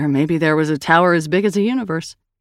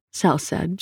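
plain reading voice

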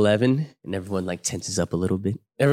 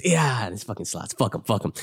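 normal talking voice

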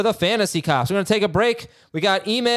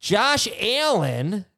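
slow, higher mock-Midwest "Josh Allen"; imitated Midwest accent; 6 semitones higher, much slower than usual. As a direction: imitate a sportscaster dragging the A. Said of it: "he does the Apple".

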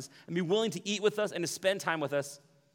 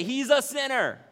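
higher, louder smug sneer, slow; the grumbling crowd, smugly judging Zacchaeus; 11 semitones higher, 10 dB louder, slower than usual. Retold or imitated. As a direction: sneer He's a sinner down your nose. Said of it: "the smug religious undertone".

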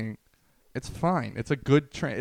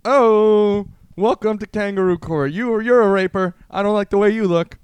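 loud, high mock-judge holler; hammed-up kangaroo court judge; 8 semitones higher, 9 dB louder than usual. Mock judge sentencing the accused in kangaroo court, hammed-up holler. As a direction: holler it like a cartoon Australian authority.

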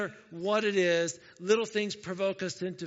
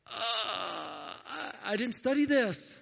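acted dismay, hesitant drawn-out start then higher; frustrated student; 4 semitones higher, much slower than usual. Student facing a test they didn't study for, mock frustration. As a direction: play a student caught unprepared. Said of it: "there's nothing more frustrating like this".

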